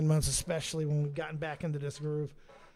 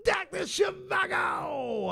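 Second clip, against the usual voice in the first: playful announcer call; 13 semitones higher, much slower than usual. Shown as a demonstration. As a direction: belt out "Doctor Shivago" like a ring announcer, stretching every syllable. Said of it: "or as I like to say".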